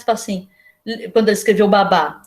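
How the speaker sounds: ordinary talk, nothing marked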